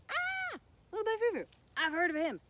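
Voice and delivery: high, loud mock-panic squeal; panicked mouse. Mouse seeing the scary bunny coming, high panicked squeal. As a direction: squeal it out in cartoon terror.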